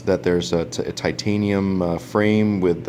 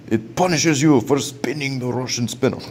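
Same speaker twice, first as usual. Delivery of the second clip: mock Russian accent, pitched higher; Dimitri; 6 semitones higher than usual. Reviewer making fun of his Russian friend, mock Russian accent. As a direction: put on a hammed-up bad Russian accent. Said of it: "Dimitri, I had to make fun of you".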